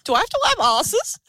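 high, laughing mock dismay. Real, not acted. Laughing at her friend's joke about wiping her kids. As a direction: giggle through the question in fake horror.

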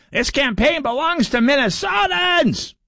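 high, slow, shouted mock screech; mock Peggy Flanagan. Host mocks Peggy Flanagan's campaign line with a high, slow screech. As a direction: belt it out in a shrill, mocking screech. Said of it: "Peggy Flanagan said it".

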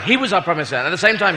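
usual speaking voice

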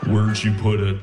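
low, creepy Buffalo Bill drone; mock creepy low sleep voice. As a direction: sound like a serial killer in a trance, low. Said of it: "Why do you sound like Buffalo Bill".

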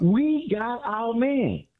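high, long-drawn triumphant cry; real excitement. Real, not acted. Sports fan celebrating his team's new player, excited. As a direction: savor each word, high and slow. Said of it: "You sound so excited".

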